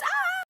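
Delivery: high-pitched squealed "ah"; excited squeal. Retold or imitated. Telling friends he's almost here, excited squeal. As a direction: let out a thin, excited squeal. Excited about magician's imminent arrival.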